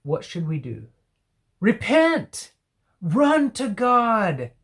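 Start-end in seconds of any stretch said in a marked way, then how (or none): 1.6-4.5 high, loud preaching cry; urgent call. Real, not acted. Preacher urging his listeners, a loud high cry.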